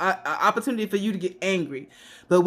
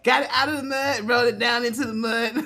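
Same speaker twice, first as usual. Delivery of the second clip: laughing; 4 semitones higher, faster than usual. Real, not acted. Laughing about her businesses.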